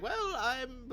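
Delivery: put-on high, quavering old lady; old grandma voice. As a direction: play a frail grandma. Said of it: "like an old grandma's like".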